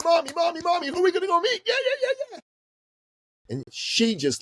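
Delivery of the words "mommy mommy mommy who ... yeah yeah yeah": put-on very high, squealing child voice; excited little boy. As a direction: imitate an overexcited kid pestering mom from the back seat. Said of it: "was all excited in the back of the car".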